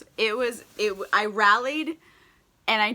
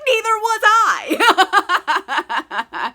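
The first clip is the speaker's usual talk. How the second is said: very slow, high and louder, laughing through it; hammed-up lovestruck hero; dramatic swoon; 9 semitones higher, 8 dB louder, much slower than usual. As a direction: ham up the romantic lead. Knightley's dramatic romantic reply.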